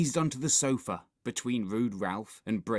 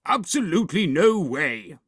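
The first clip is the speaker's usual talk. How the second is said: loud, emphatic refusal; adamant dad; 7 semitones higher, 8 dB louder than usual. A narrator's reading. Dad refusing Henry the film, loud and adamant. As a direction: put your foot down firmly.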